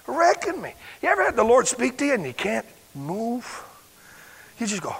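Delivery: normal talking voice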